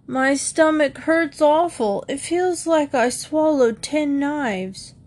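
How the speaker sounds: put-on high, drawn-out complaint of a boy feigning illness; agonized groaning. Jody tells his mother his stomach hurts, agonized groaning. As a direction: groan it out high, milking the agony. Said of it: "Jody groaned loudly and agonizingly".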